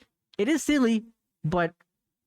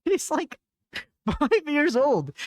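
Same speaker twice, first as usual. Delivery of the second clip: incredulous laughing outburst; 8 semitones higher, much slower than usual. Retold or imitated. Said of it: "I was just like".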